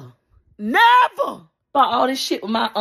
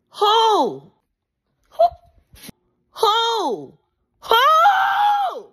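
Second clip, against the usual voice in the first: indignant who; 14 semitones higher, much slower than usual. Real, not acted. Fan venting at the brand, building to a crying shout. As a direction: wail the question at the brand.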